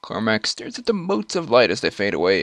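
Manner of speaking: normal talking voice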